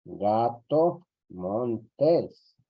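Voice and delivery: slow, clear dictation pace; teacher dictating slowly. Shown as a demonstration. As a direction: dictate it slowly so they can write. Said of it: "Vamos a ponerle igual".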